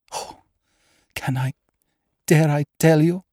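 high, halting, distressed lady; falter, then lift the pitch in dread; anguished, broken-up question; fearful hesitation. A narrator's reading. Frightened lady hesitates to confess.

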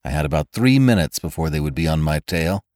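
low, wry hard-boiled narration; dry noir storyteller; noir detective. A narrator's reading.

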